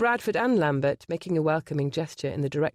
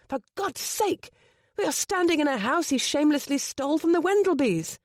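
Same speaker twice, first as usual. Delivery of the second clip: exasperated outburst; 9 semitones higher than usual. A narrator's reading. Gabriel's outburst at Bradford.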